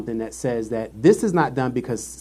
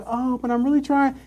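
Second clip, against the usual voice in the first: high mock whine; mock whiny plea; 12 semitones higher than usual. Quoting the coworker, high mock whine. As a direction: imitate her pleading. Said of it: "she was like".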